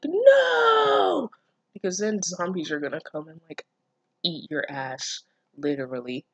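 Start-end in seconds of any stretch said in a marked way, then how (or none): 0.0-1.3 high shouted no; mock panicked scream of no. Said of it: "You can't be like".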